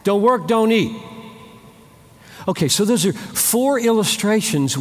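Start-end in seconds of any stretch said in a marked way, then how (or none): 0.0-1.0 firm. Shown as a demonstration. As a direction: hammer it home. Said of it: "That's Bible".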